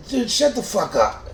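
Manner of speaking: high, loud snap; high, loud retort to the roommate; cutting him off. Retold or imitated. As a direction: shut him down hard. Cuts roommate off.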